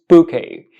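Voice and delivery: bouquet as "boo-KAY", silent T; correct pronunciation. Shown as a demonstration. Teacher summing up for learners, bouquet as "boo-KAY". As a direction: end "bouquet" on an open "kay". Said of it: "So this word is pronounced".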